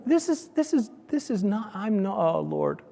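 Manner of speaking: high, soft, faltering mock dismay; dismayed protest. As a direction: stammer in high-pitched disbelief, then give up. Retold dismay at family's verdict.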